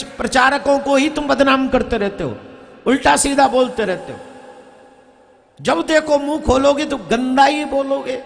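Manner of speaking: usual speaking voice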